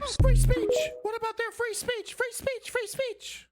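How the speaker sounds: very high, shrill squeal of outrage; mocking right-winger. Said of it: "I'm emulating what your average right-winger would say".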